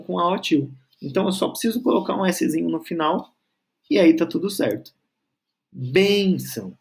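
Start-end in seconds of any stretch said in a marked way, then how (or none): 5.7-6.8 "Bênção" slowly, with BÊN held long; stressed first syllable. Shown as a demonstration. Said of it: "a sílaba tônica".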